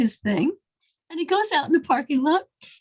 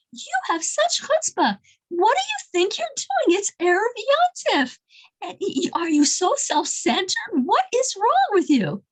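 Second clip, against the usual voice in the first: scolding rant, higher in pitch; retold outburst; 7 semitones higher than usual. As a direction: play an indignant stranger telling off the man in line. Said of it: "the guy who's flipping out said".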